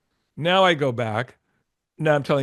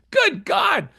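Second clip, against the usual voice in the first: high, shouted amazement; loud, high-pitched burst of awe; awestruck exclamation; 3 dB louder than usual. Retold or imitated. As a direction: cry it out as if blown away by the band. Retold reaction to an amazing band.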